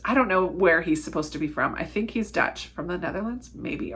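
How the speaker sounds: usual speaking voice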